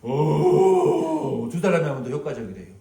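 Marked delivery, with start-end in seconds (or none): 0.0-1.5 high loud "오" to scare a bear; loud whoop. Shown as a demonstration. Preacher demonstrating how to scare off a bear, loud high shout. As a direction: belt out a big high shout. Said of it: "몸을 이렇게 크게 만들어가지고".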